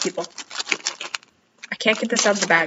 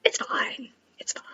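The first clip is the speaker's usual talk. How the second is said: whisper; 11 dB quieter than usual. Real, not acted. Self-reassuring about quilt.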